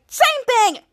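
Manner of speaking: high, loud retold snap; mad retort. Charlotte rejects Henry's apology, mad. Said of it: "she was so mad".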